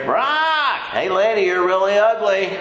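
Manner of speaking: squawk, then insult her in parrot voice. Acted. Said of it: "she walks by the parrot".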